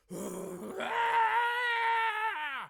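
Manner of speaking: very high, strained wrestler yell; a wordless promo howl; Ultimate Warrior scream. Shown as a demonstration. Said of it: "This is my impression of an Ultimate Warrior promo".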